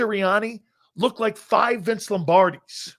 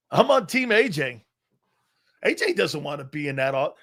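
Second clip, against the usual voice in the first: ordinary talk, nothing marked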